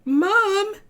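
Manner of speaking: put-on high, loud child's call; little elephant calls her mom; young elephant calls out to mom, high and loud; crying out to mom. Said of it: "Elda cried".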